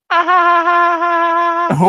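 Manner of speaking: high, loud goofy villain cackle; goofy laugh. Acted. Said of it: "Just the, the goofy laugh".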